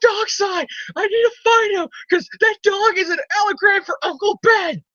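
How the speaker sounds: high, strained, screaming and half-crying; hammed-up hysterical high shouting; mock frantic yelling. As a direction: shriek like a raving lunatic. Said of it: "This is basically what Peter's doing".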